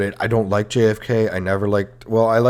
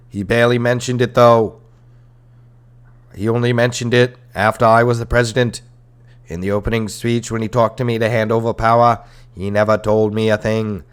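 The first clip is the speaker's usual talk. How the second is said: lightly hammed Boston accent, louder; JFK accent; 3 semitones higher, 6 dB louder than usual. Podcaster playing the president explaining his Vietnam policy, louder Boston accent. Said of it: "in my J F K accent".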